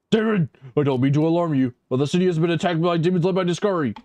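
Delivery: loud, urgent announcement; urgent warning. Pretend. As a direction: raise the alarm. Urgent warning reading dialogue.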